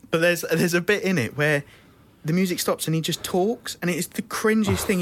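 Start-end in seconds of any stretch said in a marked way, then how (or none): none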